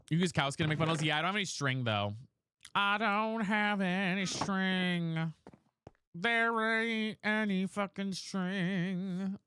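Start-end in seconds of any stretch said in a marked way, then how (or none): 2.7-9.4 slow whine. Pretend. Slow drawn complaint.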